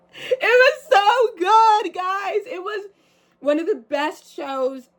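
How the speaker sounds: high, slow and drawn-out gush. Real, not acted. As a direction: stretch it out, delighted. Gushing about her best show.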